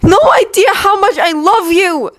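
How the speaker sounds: high, desperate cry; shouted confession. Acted. Yuri finally shouts his love at Victor. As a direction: blurt it out in tears.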